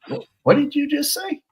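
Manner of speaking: high, startled squeak of disbelief; shocked disbelief. Retold or imitated. Stunned officer asking a partner what he just heard, shocked. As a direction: recoil at the news, voice jumping up. Hearing he nearly got tased.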